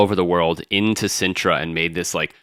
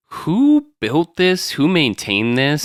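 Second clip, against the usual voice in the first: high, slow marvelling; amazed; 7 semitones higher, slower than usual. Retold or imitated. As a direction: gasp it out in wonder. Awed at gorgeous waterfalls.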